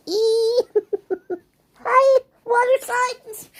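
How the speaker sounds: high, loud, slow squealed greeting; excited squeal. Real, not acted. Tarot reader greeting water signs with a delighted squeal. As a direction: squeal hello with delight as the card jumps out.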